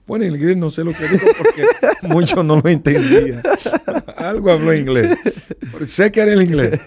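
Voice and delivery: chuckling through the words; amused, laughing telling. Real, not acted. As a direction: laugh while you tell it. Laughing while retelling demon encounter.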